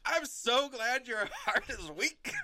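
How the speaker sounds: mock glee. Imagining Dan cheering Amy's weak heart, slow laughing mock glee. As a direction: gush it while cracking up. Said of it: "I was imagining him being like".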